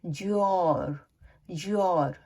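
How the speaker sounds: योर as a merged "jor"; native fast-speech pronunciation. Shown as a demonstration. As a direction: run the d and r together into योर, like "jyor". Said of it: "वो जब fast बोलते हैं".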